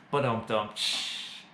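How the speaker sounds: a vocal ba-dum-tss after a pun; rimshot sound. Pretend. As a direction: mouth drum rimshot with cymbal hiss. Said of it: "Nailed it. I'm a dad".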